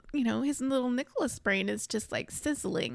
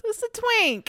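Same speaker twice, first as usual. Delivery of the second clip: mock high voice; 13 semitones higher, 4 dB louder than usual. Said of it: "She goes".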